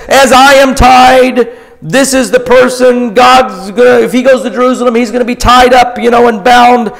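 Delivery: high, loud, slowing prophetic warning; lightly voiced prophet, urgent; prophet speaking. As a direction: warn the crowd as the prophet. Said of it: "He says".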